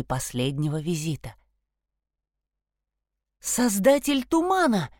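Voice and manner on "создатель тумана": cry out. Acted. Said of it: "вскричала Саша".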